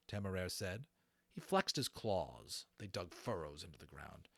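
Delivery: ordinary delivery, nothing marked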